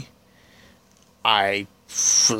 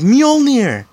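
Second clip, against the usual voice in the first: put-on high, loud childish shout; childish threat; 12 semitones higher, 6 dB louder than usual. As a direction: call it out like a bratty kid. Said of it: "she says in a very childish voice".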